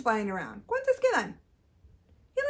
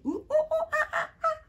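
high monkey ooh-ooh ah-ah; high, excited monkey calls for a toddler; monkey hoots; 11 semitones higher, faster than usual. Shown as a demonstration. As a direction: hoot like a playful monkey. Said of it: "Can you make a monkey sound".

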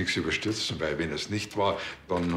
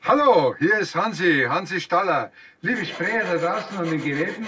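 much higher and louder, eager radio host voice; on-air greeting; 11 semitones higher, 5 dB louder than usual. Acted. Policeman addressing the sprayers on air, hammed-up radio host voice. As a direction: play the radio presenter with gusto. Cop speaking on live radio.

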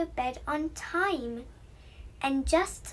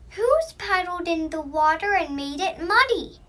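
louder, annoyed snort of a question; snorting pig; 3 semitones higher, 7 dB louder than usual. A narrator's reading. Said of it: "he snorted".

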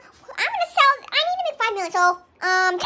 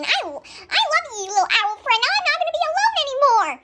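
ordinary talk, nothing marked

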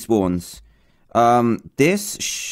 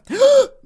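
high, sudden shocked gasp; sharp gasp; 5 dB louder than usual. Real, not acted. Gamer as the character suddenly dies, shocked gasp. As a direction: suck in a loud breath of shock.

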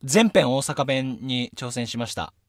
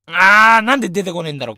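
loud, higher groan of frustration; real frustration; 5 semitones higher, 10 dB louder, faster than usual. Real, not acted. Radio host cannot remember a line, frustrated. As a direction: groan loudly as your memory fails you.

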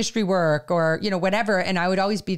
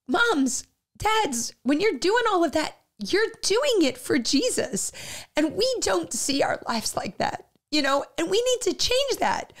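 near tears; 7 semitones higher than usual. Real, not acted. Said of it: "I'm gonna start crying".

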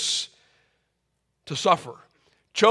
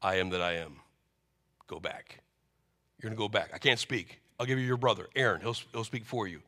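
low, much softer, solemn God speaking; 9 semitones lower, 12 dB quieter, faster than usual. Acted. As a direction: speak as God from the bush, grave and quiet. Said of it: "God speaks to Moses from the burning bush".

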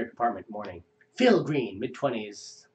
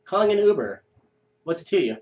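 rude stranger; 8 semitones higher than usual. Acted. Acted street quarrel.

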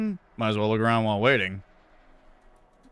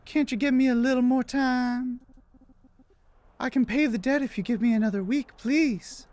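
high falsetto plea; pleading woman; 12 semitones higher than usual. Acted. Woman begging the debt collector for time, high pleading falsetto. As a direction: beg in a meek lady's high voice.

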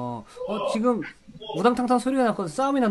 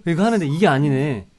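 ordinary talk, nothing marked

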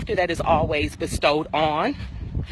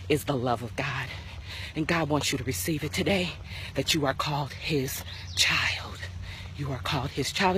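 hushed; 3 semitones lower, 7 dB quieter than usual. Real, not acted. Soft intimate close of sermon.

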